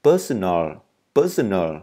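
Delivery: "personal" ending with the tongue curled up for L. Shown as a demonstration. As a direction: separate falling repeats of "personal". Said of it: "L này đọc uốn lưỡi lên một tẹo".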